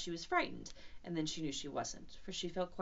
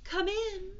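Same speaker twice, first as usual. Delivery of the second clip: high, louder sung-out call; 13 semitones higher, 7 dB louder than usual. Acted. Kanga answering a knock at the door, calling out. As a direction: lift it towards someone beyond the door.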